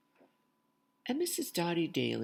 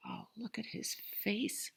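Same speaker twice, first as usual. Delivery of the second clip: whisper; 9 semitones higher, 6 dB quieter than usual. Real, not acted. Aside to children, whispered.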